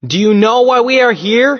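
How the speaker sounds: loud, high urgent question; calling out. Acted. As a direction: call it across a wide space. Hero calls across to the Roman.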